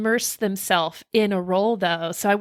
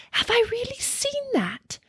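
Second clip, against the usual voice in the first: whispering; 3 dB quieter than usual. Retold or imitated. Quoted disbelieving thought.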